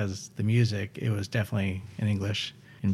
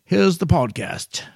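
announcer; 8 semitones higher, 4 dB louder, slower than usual. Pretend. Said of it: "before we get any further into my spiel".

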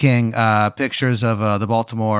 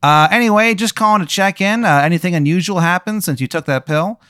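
a higher, perky corporate caller; cheery phone caller; 6 semitones higher, 3 dB louder than usual. Acted. Game master voices Veronica calling the player, perky and higher. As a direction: chirp it like a customer service rep.

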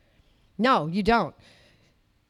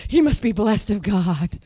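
mocking awed churchgoer; 7 semitones lower than usual. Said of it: "The church".

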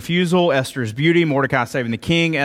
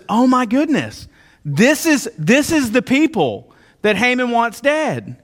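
king's shock; 7 semitones higher than usual. Retold or imitated. King's realization acted out.

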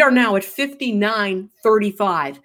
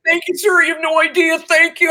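high-pitched, gushing gratitude; effusive thanks; 7 semitones higher than usual. Retold or imitated. Runner thanks the stranger who found her keys, effusively. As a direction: pour out thanks, pitched well up. Thanking key finder while out of breath.

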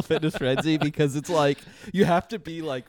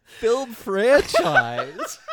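incredulous; 9 semitones higher, 4 dB louder, much slower than usual. Real, not acted. Incredulous at the description.

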